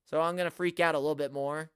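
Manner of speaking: normal talking voice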